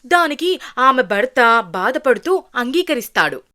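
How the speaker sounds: usual speaking voice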